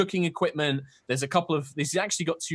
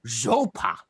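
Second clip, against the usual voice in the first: put-on high, curious old turtle creature voice; a wary hermit peering at strangers; old turtle man; 7 semitones higher than usual. GM voicing the old turtle hermit to the party.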